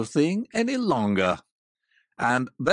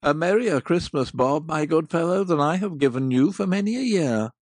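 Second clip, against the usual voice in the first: lower, louder, hearty and sincere; earnest old Scrooge; 3 semitones lower, 4 dB louder than usual. Acted. Scrooge wishes Bob a merry Christmas, earnest and hearty. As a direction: pour out real goodwill to your clerk. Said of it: "with an earnestness that could not be mistaken".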